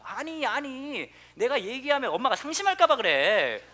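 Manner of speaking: put-on high, drawn-out whiny little girl; annoyed child. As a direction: play the fed-up daughter sparing mom's feelings. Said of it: "짜증을 내면서 이렇게 얘기를 하더라고요".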